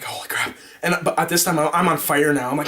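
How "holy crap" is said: low, unvoiced holy crap; stunned under your breath; hushed amazement; whispered disbelief. After hitting one.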